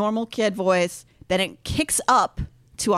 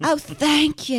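a breathy, higher mock sexy thank-you; imitating breathy pop star; 5 semitones higher than usual. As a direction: coo it like a teasing pop star. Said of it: "sexy moan every time she talks".

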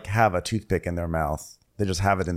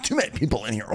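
higher, put-on fluster; mock flustered; 4 semitones higher, 3 dB quieter, much faster than usual. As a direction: imitate someone overwhelmed by the crowd. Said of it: "all flustered and he's like".

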